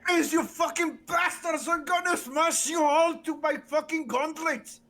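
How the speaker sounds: high, loud Scottish bellow; Scottish accent shout. Acted. Player as the berserker raging in Scottish accent, shouting. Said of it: "I can't do a better Scottish accent".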